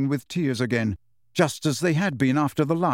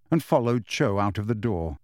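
usual speaking voice

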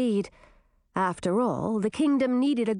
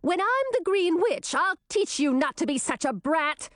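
threatening; 9 semitones higher than usual. Acted. Threat shouted after sister.

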